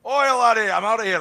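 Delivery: put-on loud, high-pitched Scottish accent. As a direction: bellow it as a hammy Scotsman. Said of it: "That's my Scottish voice".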